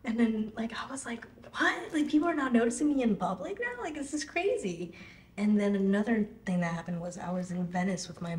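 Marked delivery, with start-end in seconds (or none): 1.6-4.9 much higher, amazed outburst; stunned disbelief. Retold or imitated. Recalling being recognized in public, high stunned outburst. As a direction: squeal out your disbelief.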